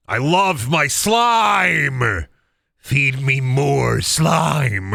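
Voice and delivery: slow, loud, drawn-out monster craving; slime-hungry monster. Pretend. Streamer pretending to crave slime like a monster. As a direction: ham up a creature starving for slime.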